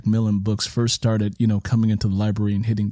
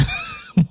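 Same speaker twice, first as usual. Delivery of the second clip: short laugh. Real, not acted. Laughs about reading at work.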